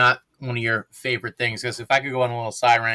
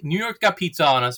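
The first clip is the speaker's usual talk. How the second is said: normal talking voice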